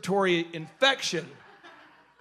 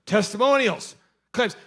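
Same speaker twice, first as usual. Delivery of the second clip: ordinary delivery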